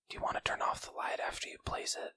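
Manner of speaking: quick, soft whisper; discreet whisper. Real, not acted. Guest suggesting host turn off the light, whispering. Avoiding more callers at door.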